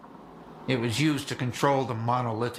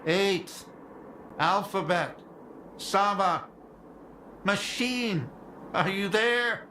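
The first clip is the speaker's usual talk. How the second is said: higher, raised radio call; calling out over radio; 7 semitones higher, slower than usual. Acted. Radio operator hailing missing squads by callsign, calling out. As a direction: hail each squad name in turn, hoping for an answer.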